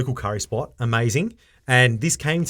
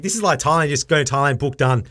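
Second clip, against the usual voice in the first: ordinary talk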